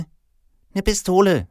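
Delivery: put-on very high, drawn-out child voice; little girl. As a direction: announce the wish as a little girl. Little girl names her wish.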